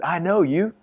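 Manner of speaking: put-on booming, high American accent; loud mock American drawn up high; big American accent. American newcomer greeting him in the street, big American accent. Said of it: "in a big American accent".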